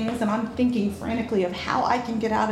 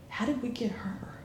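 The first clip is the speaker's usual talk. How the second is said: breathy conspiratorial whisper to his wife; 10 dB quieter than usual. Retold or imitated. Husband whispering to his wife about their guest, hushed. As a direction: hush it so the guest won't hear.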